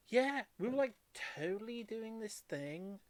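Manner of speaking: mock an older woman's Valley Girl speech, lightly; Valley Girl accent. Retelling a stranger who spoke to her, in a mild Valley Girl accent. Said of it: "Valley Girl".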